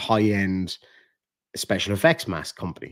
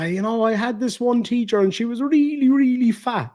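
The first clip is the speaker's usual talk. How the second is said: put-on high-pitched kid comic voice; imitating a young comedian, high and exaggerated; re-enacted stand-up bit; 12 semitones higher than usual. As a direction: pitch it right up, hamming up the joke. Re-enacts his childhood stand-up bit.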